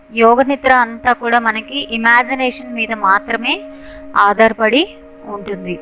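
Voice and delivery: guided meditation. Real, not acted. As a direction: guide listeners step by step, with long pauses. Leads yoga nidra relaxation.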